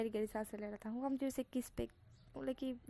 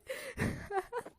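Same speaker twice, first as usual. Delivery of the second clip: shrieking laugh, much higher; 13 semitones higher than usual. Real, not acted. Narrator still laughing, high laugh. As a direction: let the laugh spill out. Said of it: "मुझे हंसी आ रही है".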